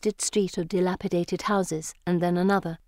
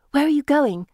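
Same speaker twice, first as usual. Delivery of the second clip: high, loud panicked question; alarmed, raised-pitch outburst; frantic; 8 semitones higher, 5 dB louder than usual. A narrator's reading. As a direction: blurt it as they walk away. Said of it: "I ask frantically".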